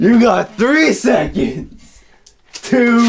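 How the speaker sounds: slow, high shouted countdown; gravelly old tycoon. Pretend. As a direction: count him down like an angry boss.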